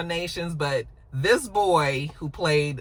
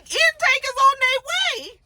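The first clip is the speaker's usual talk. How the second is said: very high, louder, disbelieving; incredulous repeat; 5 dB louder than usual. Retold or imitated. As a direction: echo it back in shock. Ozzy repeats the news in disbelief.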